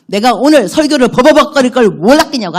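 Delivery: loud, punchy preaching cry; emphatic shout. Real, not acted. 설교 중 회중에게 힘주어 외치는 목소리. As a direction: drive the point home loudly.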